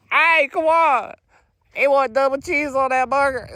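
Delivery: hammed-up shout to the kitchen; mock worker calling out. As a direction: call the order like the counter worker. Imitating worker calling order.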